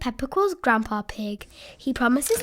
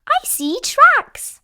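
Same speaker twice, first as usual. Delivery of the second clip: very high, loud excited cry; Peppa spotting tracks; 14 semitones higher, 8 dB louder, much slower than usual. Acted. Peppa discovering animal tracks on the walk, high excited squeal. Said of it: "she says".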